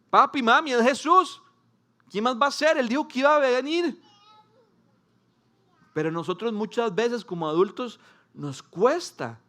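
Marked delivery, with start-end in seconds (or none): 0.1-4.0 put-on high, loud eager child voice; a hint of a kid tugging at parents; excited child. Child telling papi and mami it is Jesus, eager and excited. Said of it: "un niño lo hubiera entendido así".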